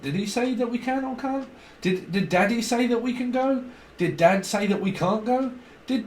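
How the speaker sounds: high, lightly voiced little kid asking; a hint of a puzzled child checking with Dad; confused child. Child unsure whether Daddy said yes, lightly voiced and high.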